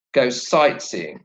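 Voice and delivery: "sightseeing" stressed on SIGHT, drawn out slowly; slow model phrase, SIGHT stressed in "sightseeing". Shown as a demonstration. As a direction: lean on "sight", let "seeing" fall away. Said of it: "the word sight takes the stress".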